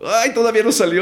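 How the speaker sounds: high, loud exasperated outburst; mock frustration. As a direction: wail it, hammed-up, after a botched take. Acting out frustration at failed take.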